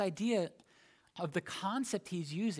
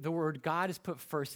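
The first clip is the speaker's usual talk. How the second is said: usual speaking voice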